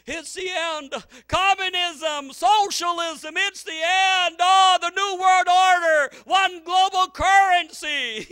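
loud, high, slow alarmist ranting; mock doom talk host. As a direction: rant like a radio doom prophet. Mocking talk show host doom.

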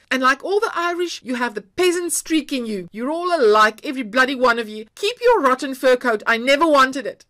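loud, high furious scream. A narrator's reading. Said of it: "let a scream out of her".

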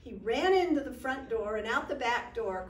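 usual speaking voice

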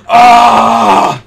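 loud, high "ah" of uproar; mock crowd yell; imitated crowd scream. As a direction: scream like a whole crowd reacting. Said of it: "everyone was like".

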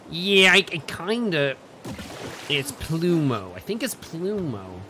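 stretched-out, slow imitation; loosely put-on accent with elongated vowels; Mister Bitch. Player practising a crewmate's voice, slow bad accent. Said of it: "He's like".

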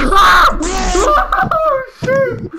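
loud high shriek into laughs; scream into laughter. Real, not acted. Scared by a noise.

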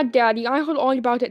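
normal talking voice

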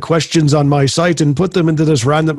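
badly faked British reading voice; bad British accent. Shown as a demonstration. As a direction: attempt a British accent, knowingly awful. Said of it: "Do a British accent on it".

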